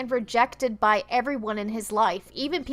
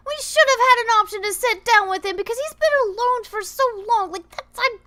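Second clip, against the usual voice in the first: higher, louder rant; indignant rant; 9 semitones higher, 7 dB louder than usual. Real, not acted. Player ranting at the developers, indignant and higher. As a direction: complain heatedly about the story. Ranting about missing option.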